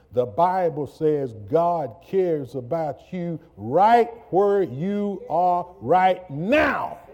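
rising into a shouted, high preaching peak; full-force preaching. Acted. Preacher to congregation, building to a shout.

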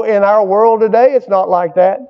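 ordinary talk

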